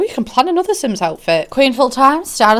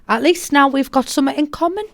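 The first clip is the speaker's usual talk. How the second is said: normal talking voice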